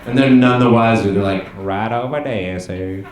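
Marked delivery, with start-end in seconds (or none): 1.6-3.0 low, soft put-on Southern accent; mock Southern accent, low and easy. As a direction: imitate a polite Southern exchange. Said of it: "I just do a Southern accent".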